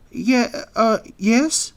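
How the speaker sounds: high-pitched, faltering yes; hesitant anteater. A narrator's reading. Anteater answers pained friend.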